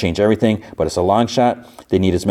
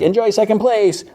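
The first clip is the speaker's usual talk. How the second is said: high, sing-out taunt; taunting call. Retold or imitated. Racer teases the driver left behind, taunting. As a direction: call it out gloatingly in a much higher pitch.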